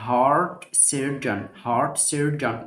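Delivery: heart surgeon slowly, clear h; slow model word. Shown as a demonstration. Teacher modelling heart surgeon slowly. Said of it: "جراح للقلب heart surgeon".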